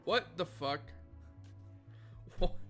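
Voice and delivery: normal talking voice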